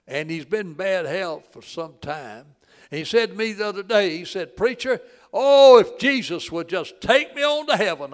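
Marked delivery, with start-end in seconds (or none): 4.6-8.1 a loud high cry of longing, slowed on "oh"; an old man calling out, aching for heaven. Retold or imitated. Dying man longs for heaven.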